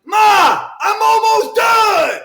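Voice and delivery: high, loud, drawn-out yell; shouting. Acted. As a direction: holler back at mom. Yelling back to mom.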